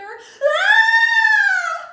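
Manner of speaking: loud high shriek of fright; scared scream. Acted. Storyteller as little girl hearing the door squeak, scared scream. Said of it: "Can you be scared with her?".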